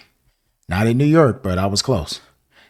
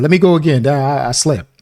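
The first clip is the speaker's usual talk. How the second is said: eager retold plea; 5 semitones higher, 6 dB louder than usual. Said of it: "I said".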